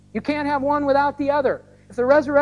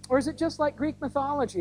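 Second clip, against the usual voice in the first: ordinary talk, nothing marked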